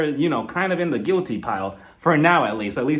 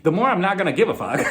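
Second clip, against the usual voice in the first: ordinary delivery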